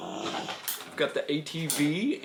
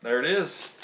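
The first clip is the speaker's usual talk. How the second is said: ordinary talk, nothing marked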